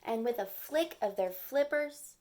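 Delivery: ordinary delivery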